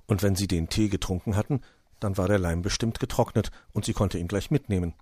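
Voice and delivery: plain reading voice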